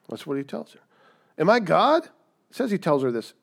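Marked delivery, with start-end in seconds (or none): 1.4-2.1 higher and louder indignant question; mock-indignant Jacob; angry retort. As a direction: Jacob snapping back in anger. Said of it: "in his anger".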